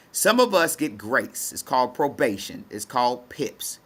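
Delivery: ordinary talk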